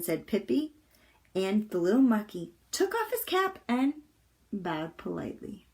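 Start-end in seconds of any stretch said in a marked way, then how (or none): none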